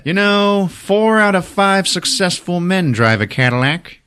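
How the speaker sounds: high-pitched hard-sell patter; smooth-talking salesman. Retold or imitated. Salesman pitching a Cadillac to a customer, high smooth-talking sell. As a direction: pitch it big, like a car salesman. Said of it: "smooth-talking salesman".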